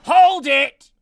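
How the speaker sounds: loud, high courtroom interjection; shouted objection. Acted. Lawyer interrupting an arrest, shouted objection. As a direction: cut in with a sharp shout.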